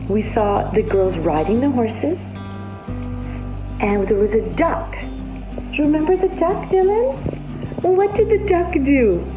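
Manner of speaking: ordinary talk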